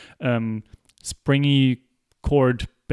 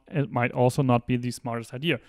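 ordinary talk

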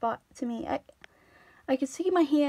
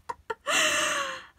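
long high-pitched laugh; high squealing laugh; 13 semitones higher than usual. Real, not acted. Wordless outburst after mock plea.

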